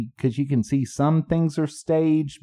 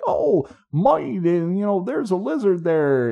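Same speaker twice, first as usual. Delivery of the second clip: hammed-up Australian accent, much higher; mock Australian wildlife host, excited and pitched way up; Steve Irwin Australian accent; 7 semitones higher than usual. Joking with a friend about his dad's TV shows, imitating an Australian accent. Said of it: "Is that your Australian accent?".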